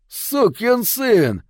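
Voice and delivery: high, drawn-out yell of rage; enraged yell. A narrator's reading. Bobby leaping at the biker to strike, enraged yell. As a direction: yell it high, dragging out each word. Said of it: "завопил Бобби".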